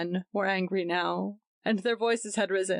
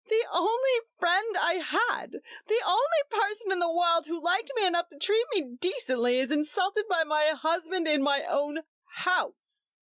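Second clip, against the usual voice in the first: sobbing; 11 semitones higher than usual. A narrator's reading. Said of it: "she sobbed".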